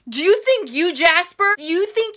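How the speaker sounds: ordinary talk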